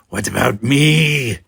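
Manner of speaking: slow, loud demanding Klingon. Acted. Kodash demanding to join, slow and loud. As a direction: demand it hard. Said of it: "demanded Kodash".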